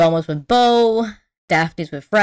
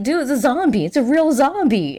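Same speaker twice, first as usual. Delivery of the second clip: raised pitch, urgent retelling; mock alarm; 7 semitones higher than usual. Scooby and Shaggy telling the gang about a zombie, mock alarm. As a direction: insist on it, pitched up. Frightened pair report a zombie.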